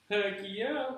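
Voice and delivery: high, loud retold enthusiasm; eager agreement. Employee asked to sign a petition against the manager, eager. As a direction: jump at it, voice shooting up.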